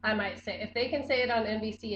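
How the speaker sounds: ordinary talk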